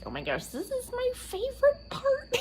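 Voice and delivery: very high gleeful squeal; imitating thrilled mom. Imitating mom at the dryer, high gleeful. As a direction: squeal like a delighted mother. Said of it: "she's like".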